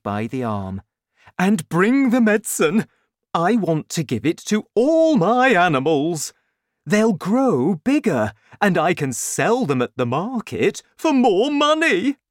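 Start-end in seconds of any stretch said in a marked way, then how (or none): 1.4-12.2 loud, high, gleeful scheming; raised voice, mounting excitement; excited father. A narrator's reading. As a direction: get carried away, stretching the big words.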